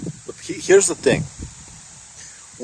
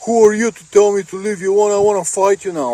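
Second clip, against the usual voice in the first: higher, faster mock tough guy; aggressive stranger; 7 semitones higher, 4 dB louder, faster than usual. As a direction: play the offended brawler picking a fight. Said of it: "he's like".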